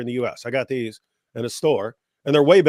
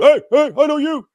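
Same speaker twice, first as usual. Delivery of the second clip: much higher, louder call from a passing car; shouted greeting; 9 dB louder than usual. Retold or imitated. Imitating drivers who recognize him, shouting out of a car. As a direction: yell it like a stranger spotting you from the road. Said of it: "people driving down the road".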